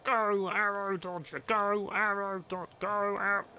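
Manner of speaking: high, slow Cockney cry on a loop; Cockney accent. Retold or imitated. Speedrunner shows chat the call that drives him insane, Cockney accent. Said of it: "the impression's pretty accurate because I had".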